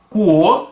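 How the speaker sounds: "Cuo" com o u discreto; demo glide. Shown as a demonstration. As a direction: lean on the o of "Cuo". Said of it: "esse finalzinho aqui".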